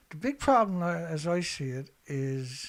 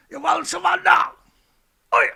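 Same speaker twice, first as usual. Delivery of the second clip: louder, higher mocking shout of the question; mock Trump demand; 13 semitones higher, 15 dB louder, faster than usual. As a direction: sneer it like a bully demanding surrender. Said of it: "Trump demands unconditional surrender".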